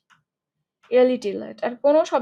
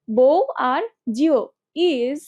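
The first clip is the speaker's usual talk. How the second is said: বউ আর জিও spelled out slowly, each part apart; slow mnemonic; 3 dB louder, much slower than usual. Shown as a demonstration. Teacher slowly building a memory sentence. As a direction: drag out বউ আর জিও for the class. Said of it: "mnemonic chunk for bourgeois".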